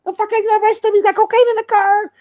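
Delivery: squeaky voice pitched far up, frantic; mock high-pitched rat from the movie Blow; high panicked voice. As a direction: shriek it like a panicking snitch. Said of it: "He's like".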